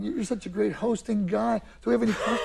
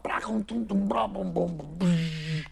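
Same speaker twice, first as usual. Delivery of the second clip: mouth-drummed song ending; vocal drum fill; 3 semitones lower, 3 dB quieter, slower than usual. Shown as a demonstration. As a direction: imitate tumbling drums and a buzzing cymbal. Mimics drums ending the song.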